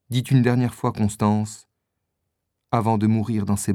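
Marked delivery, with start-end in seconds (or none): none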